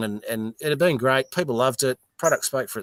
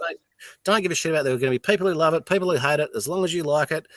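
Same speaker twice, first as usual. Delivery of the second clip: fast mentor advice; 4 semitones higher, 3 dB louder, faster than usual. Retold or imitated. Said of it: "She goes".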